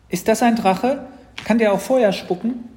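high, loud, eager questions; excited little sister. Acted. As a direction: fire off questions like a thrilled child. Sister quizzes about the creature.

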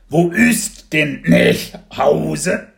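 a little dragon puzzling it out word by word; drawn-out wondering dragon voice; confused baby dragon. Acted.

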